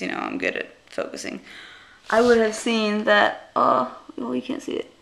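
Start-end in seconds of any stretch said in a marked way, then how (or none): none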